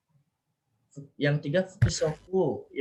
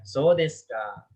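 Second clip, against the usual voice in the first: drawn-out "So desu ka" with a downward ending; falling intonation; 3 dB louder, much slower than usual. Shown as a demonstration. Teacher repeating the model for students, "So desu ka" falling. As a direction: say "So desu ka" slowly, dropping on "ka". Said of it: "Turun ya?".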